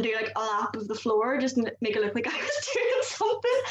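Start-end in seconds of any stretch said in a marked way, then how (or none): 2.3-3.6 laughing. Real, not acted. Laughing through retail story.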